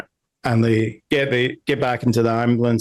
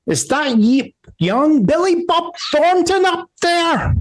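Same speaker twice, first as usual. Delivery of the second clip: very high Southern matron calling out; hammed-up old Southern lady, pitched way up; old Southern woman; 3 dB louder than usual. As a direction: holler up in a bad Southern accent. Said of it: "that old Southern woman voice going".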